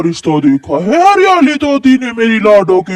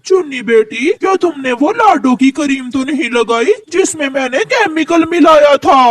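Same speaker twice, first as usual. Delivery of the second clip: usual speaking voice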